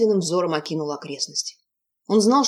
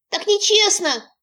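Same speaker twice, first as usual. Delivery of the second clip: high, slow wailing protest; wailing hut; 14 semitones higher, 3 dB louder, slower than usual. Acted. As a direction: wail it like a sulking creature. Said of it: "завопила избушка".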